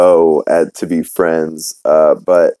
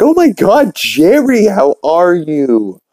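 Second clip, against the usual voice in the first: Peter, surprised; 11 semitones higher, slower than usual. Acted. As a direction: shriek the greeting up high. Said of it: "He's like".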